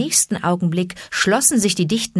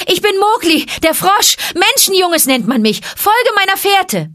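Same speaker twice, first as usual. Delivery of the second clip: very high, loud yell; yelling mid-leap; 14 semitones higher, 7 dB louder than usual. A narrator's reading. Mowgli yelling to the vulture mid-leap, very high. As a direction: yell it while flying through the air. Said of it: "Er gellte diese letzten Worte".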